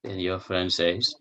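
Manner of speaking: usual speaking voice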